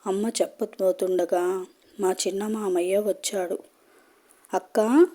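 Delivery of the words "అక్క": loud, high, happy hello to his sister; a delighted uncle's booming hello; cheerful greeting. A narrator's reading. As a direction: call out a bright welcome from the doorway. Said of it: "అని పలకరింపుగా వచ్చాడు".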